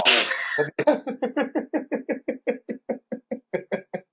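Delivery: a long, high burst of laughter, trailing down. Real, not acted. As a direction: crack up at your own confession. After admitting eating raw stuff.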